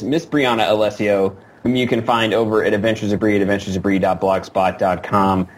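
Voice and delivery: usual speaking voice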